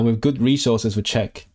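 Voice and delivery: ordinary talk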